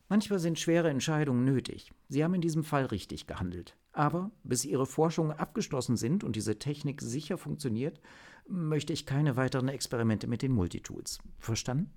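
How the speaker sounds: approve, then set a firm limit; understanding but firm chief. Acted. (verständnisvolle, bestimmte Chefin)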